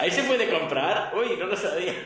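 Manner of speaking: feigned surprise, laughing along; laughing mock surprise. Joking with the host about shopping there, laughing mock surprise. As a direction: play innocent through giggles.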